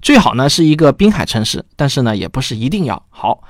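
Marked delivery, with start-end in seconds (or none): none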